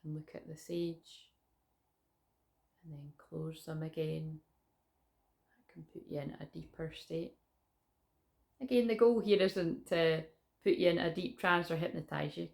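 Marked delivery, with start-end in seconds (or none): 0.0-7.4 guided meditation. Acted.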